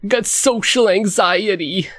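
high, dragged-out anxious impression; Woody Allen. Co-host keeps imitating the anxious actor, high slow impression. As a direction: stretch it out high and neurotic, played for laughs. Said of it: "That's him".

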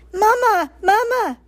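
put-on high, loud baby-bird cry; peeping gosling. A narrator's reading. Gosling calling to the robot as mother, high peeping. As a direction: peep like a needy hatchling. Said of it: "peeped the gosling".

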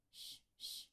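loud, urgent shushing; two strong shushes to soothe; comforting shush. A narrator's reading. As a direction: hush a wailing person. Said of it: "Mushika comforted her".

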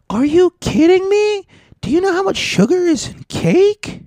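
very high, loud squeaky caricature; mock outraged shriek. Office worker offered birthday cake, mock outraged shriek.

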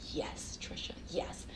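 hushed breathy whisper. Retold or imitated. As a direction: whisper it intimately. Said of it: "he'd say".

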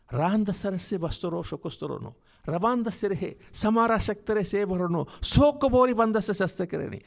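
rising burst of prayer syllables; praying in tongues. Real, not acted. Prays in tongues.

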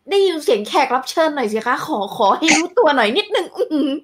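high, laughing host call-out. Real, not acted. Host calling for the absent guest mid-laugh.

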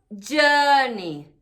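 "journey" with a long er vowel, no R; British "journey" with a silent R; "journey" rhyming with bird; correct journey. Shown as a demonstration. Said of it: "No dices journey, es journey".

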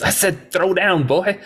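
lightly acted high-pitched taunt; mock tough-guy demand; acted challenge. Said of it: "And he goes".

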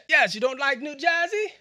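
high-pitched mock English accent. Shown as a demonstration. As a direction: ask it in a hammed-up English accent. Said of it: "I would do it in an accent".

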